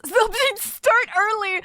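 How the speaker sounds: amused laughing reread. Real, not acted. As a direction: laugh while reading it out.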